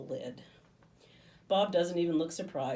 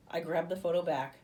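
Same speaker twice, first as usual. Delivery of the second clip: usual speaking voice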